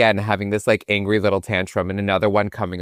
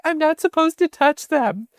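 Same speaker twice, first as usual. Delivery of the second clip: high, prim mom voice. Retold or imitated. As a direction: insist primly you can't touch them. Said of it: "she's like".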